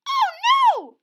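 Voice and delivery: extremely high, loud screech; cartoon puffin shriek. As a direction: screech in panic. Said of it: "screeched Puffin Muffin".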